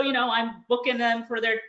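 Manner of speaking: ordinary delivery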